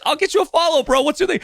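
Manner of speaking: high, eager voice of an amazed mover; high-pitched mover voice in disbelief. Retold or imitated. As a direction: play the stunned, thrilled mover. Mahmoud's amazed reaction retold.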